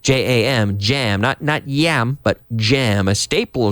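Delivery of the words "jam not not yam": normal talking voice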